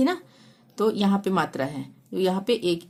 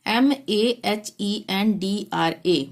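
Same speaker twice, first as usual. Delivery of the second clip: spelling out letters; much slower than usual. Shown as a demonstration. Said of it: "Mahendra".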